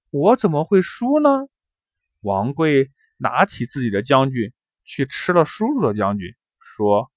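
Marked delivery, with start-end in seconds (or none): 0.1-1.3 higher-pitched baffled question; perplexed uncle questioning a child; puzzled. A narrator's reading. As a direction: ask it in bewilderment, lifting the voice. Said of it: "叔叔疑惑地说".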